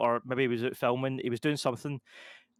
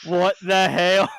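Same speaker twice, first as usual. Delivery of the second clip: slow, loud, drawn-out disbelief; retelling the director finding the phone in his basement, startled; shocked what the hell; 4 semitones higher, 10 dB louder, much slower than usual. Retold or imitated. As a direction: stretch it out, stunned and loud.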